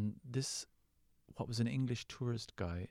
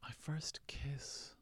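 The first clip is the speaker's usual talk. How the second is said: whispered musing; 4 semitones higher, 7 dB quieter, much slower than usual. Real, not acted. Musing over the question aloud.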